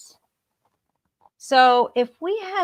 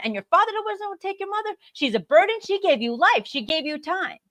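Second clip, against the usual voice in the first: fast, louder, higher indignant rant; reactive outrage; 5 semitones higher, 5 dB louder, faster than usual. Shown as a demonstration. Reacting to a friend's news, heated and indignant. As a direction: pile on the blame, getting heated. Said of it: "See the difference?".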